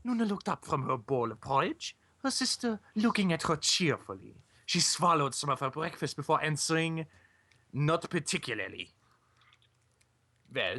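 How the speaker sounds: put-on story reading in a wobbly German accent; put-on, inconsistent German accent. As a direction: read with a German accent slipping into French. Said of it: "My German accent keeps going French".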